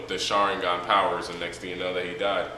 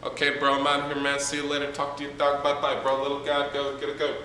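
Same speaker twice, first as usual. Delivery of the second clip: casual bro voice; 6 semitones higher than usual. Retold or imitated. Madara greeting grieving Hashirama, casual bro voice. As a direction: greet him like a chill homie. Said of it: "he's like".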